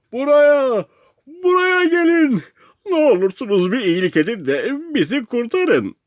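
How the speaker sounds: desperate shouting. A narrator's reading. Castaway calls a passing rescue boat, desperate shouting. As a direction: yell pleadingly across the water, pitch way up. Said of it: "diye bağırıyordum".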